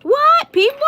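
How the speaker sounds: high, loud disbelieving cry; stunned high exclamation; amazed. Real, not acted. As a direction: squeal it in happy shock. Amazed at like count.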